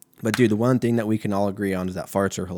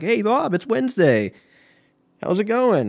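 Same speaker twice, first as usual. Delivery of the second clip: high, bright mock greeting, much higher; chirpy coworker put-on voice; cheery office coworker; 11 semitones higher, 4 dB louder than usual. Coworker greets Bob on Wednesday, sing-song mock cheer.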